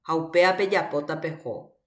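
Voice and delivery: ordinary delivery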